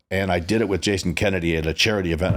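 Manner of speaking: ordinary talk, nothing marked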